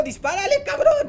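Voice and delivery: high, loud exasperated order; mock panicked yell. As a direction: scream the order in comic desperation. Yells at helper under attack. (grito agudo de desesperación fingida)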